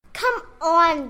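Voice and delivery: ordinary delivery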